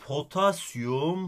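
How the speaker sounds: "potasyum" stretched out slowly, rising at the end; slow demo. Shown as a demonstration. Teacher reads potassium slowly, prompting the student. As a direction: draw out "potasyum" slowly and rise, waiting for the ending. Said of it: "O zaman ben bunu".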